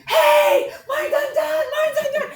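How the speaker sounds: very high, loud mock-panic shriek; squealing alarm, hammed-up panic; panicked riders. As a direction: imitate terrified riders yelling for help.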